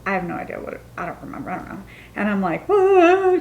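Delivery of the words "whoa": loud, high-pitched fake bawl; mock crying wail. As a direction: wail like an exaggerated crybaby. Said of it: "just crying".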